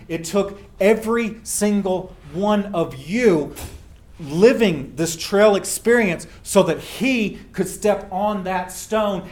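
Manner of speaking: drawn out, building to the stone; slow each phrase down to land the point; solemn, deliberate climb in intensity; slow emphatic build. Real, not acted. Moving climax of his speech.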